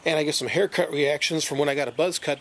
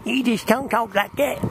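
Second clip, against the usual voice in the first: mock southern accent; 6 semitones higher than usual. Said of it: "You making fun of the way I'm talking, girl?".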